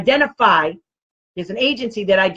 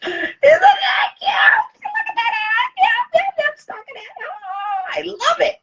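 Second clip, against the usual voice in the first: very high, laughing, slow squeals of delight; gushing squeal; slower than usual. Real, not acted. Crafter showing viewers her hat, gushing squeal. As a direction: squeal over the hat like a proud maker.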